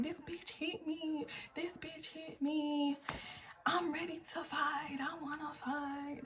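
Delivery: high, slow and soft, a mock wail; mock upset outburst. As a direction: play her own outburst back as a whimpering parody. Retelling her own outburst after fight.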